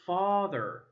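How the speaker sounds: usual speaking voice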